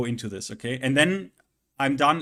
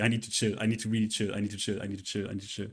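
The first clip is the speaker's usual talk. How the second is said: calming himself; 6 semitones lower, 6 dB quieter, much faster than usual. Real, not acted. Streamer calming his own anger, fading mutter. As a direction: lower and softer, fading to a murmur. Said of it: "I'm getting angry".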